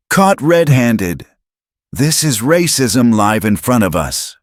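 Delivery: high, loud outrage; outraged. A narrator's reading. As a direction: shout the comment in outrage. Comments flooding in.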